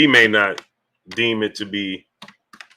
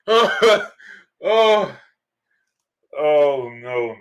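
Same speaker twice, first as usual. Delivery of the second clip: laughing; much slower than usual. Real, not acted. Recovering from a laughing fit.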